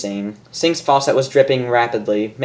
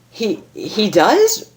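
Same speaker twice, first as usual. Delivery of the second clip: stunned; 7 semitones higher, much slower than usual. Acted. Rainbow stunned by news.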